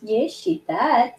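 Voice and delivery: soft, lilting pet voice; slow, high-pitched doting. Real, not acted. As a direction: coo to a beloved pet. Baby-talk to a pet.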